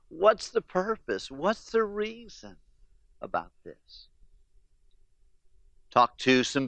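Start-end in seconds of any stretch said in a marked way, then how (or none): 0.1-4.1 soft, slow, tearful questioning; a grieving mother's hushed why; tearful plea. Retold or imitated. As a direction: ask it through tears, barely audible. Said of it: "looks up through tears".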